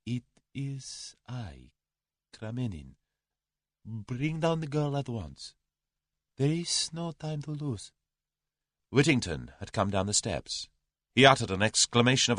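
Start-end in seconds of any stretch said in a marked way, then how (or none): 0.1-7.9 slow, soft, shaky-voiced plea to the butler; slow and soft, voice not quite steady; a touch of unsteady fear. A narrator's reading. Kremenin, frightened, orders the girl brought down, unsteady. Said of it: "his voice was not very steady".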